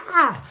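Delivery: high, sharp mock yelp of shock. Cinemagoer startled by an ad, high yelp. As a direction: yelp like you've been ambushed. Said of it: "It's like".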